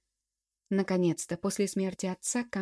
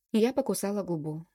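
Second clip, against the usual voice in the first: plain reading voice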